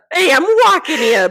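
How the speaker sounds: put-on loud, pushed Long Island accent. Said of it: "it's Long Island".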